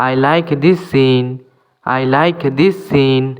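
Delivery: slow, clear English for learners; careful dictation pace; slow teaching demo. Shown as a demonstration. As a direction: spell each word out slowly for students. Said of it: "teacher repeats example slowly".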